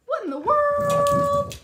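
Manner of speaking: high, slow amazed question. Real, not acted. Owner amazed at the dog's trick, high and slow. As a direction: marvel at the dog. Surprised at dog.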